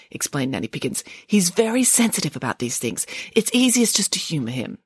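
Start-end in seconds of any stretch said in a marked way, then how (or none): none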